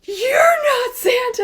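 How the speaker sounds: loud, high, drawn-out accusing shout; slow, hammed-up furious cry; mad kid. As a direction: imitate an outraged child exposing a fraud. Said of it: "he is so, like, mad".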